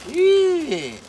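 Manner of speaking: sudden high, loud shout of shock; surprised shout. Real, not acted. Unboxing and startled by something, shouting in shock. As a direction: blurt it out, caught off guard.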